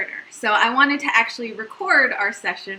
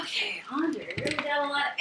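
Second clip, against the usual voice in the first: whispered aside; whispering; 2 semitones higher, 9 dB quieter than usual. Real, not acted. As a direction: whisper it off-mic. Quick aside to husband on camera.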